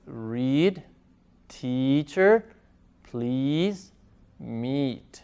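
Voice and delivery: "Read, teacher, please, meat" one by one, each with ee; soft spaced list, "Read, teacher, please, meat", long ee in each; demonstrated ea sound. Shown as a demonstration. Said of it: "observen cómo se pronuncian estas dos letritas".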